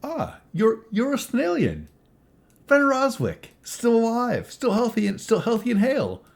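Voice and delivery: high-pitched mock delight, loud; sarcastic. [name] greets a drinker he knows, high-pitched sarcasm. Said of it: "sarcastic as always".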